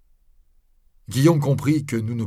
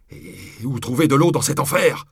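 loud, higher, climbing exclamation; exasperated outcry; 4 semitones higher, 6 dB louder than usual. A narrator's reading. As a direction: cry out in desperate frustration. Guillaume despairs of finding water in fire.

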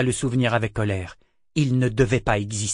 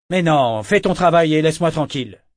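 irritated dismissal; 5 semitones higher, 7 dB louder than usual. A narrator's reading. Captain dismissing helmsman.

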